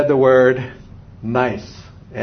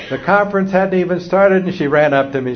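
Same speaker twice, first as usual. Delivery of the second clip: normal talking voice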